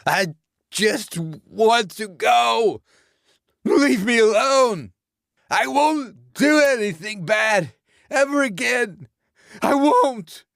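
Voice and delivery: a terrified victim begging for mercy; desperate begging. A narrator's reading. Beaten Lance begs the dead man to let him go.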